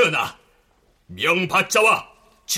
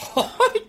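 short laugh. Real, not acted. Laughs at the barmaid's retort.

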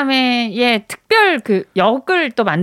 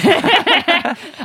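laugh; 7 semitones higher than usual. Real, not acted. Laughing at own pitch.

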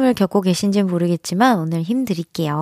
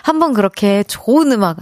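normal talking voice

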